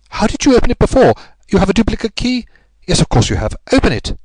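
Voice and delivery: brisk detective firing questions; Holmes, urgent and commanding. A narrator's reading. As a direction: press her hard, then order it.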